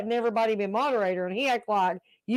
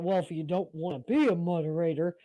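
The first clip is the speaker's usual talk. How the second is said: mock serious; 5 semitones lower, 4 dB quieter than usual. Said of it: "he was all serious about".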